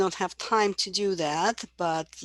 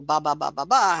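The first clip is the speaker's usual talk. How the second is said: imitating baby babble; 6 dB louder than usual. Shown as a demonstration. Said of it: "they'll do something like this".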